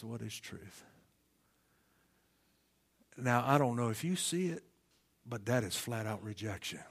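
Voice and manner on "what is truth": soft, low, even reading of the question; quiet question. Retold or imitated. Preacher reads Pilate's question to the church, soft and low. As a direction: let the question drop quietly. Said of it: "and he says".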